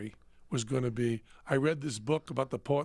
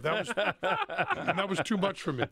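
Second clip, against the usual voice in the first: laughing; 3 semitones higher than usual. Real, not acted. Mocking own tiny adventure to a friend, laughing. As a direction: laugh at how little it took.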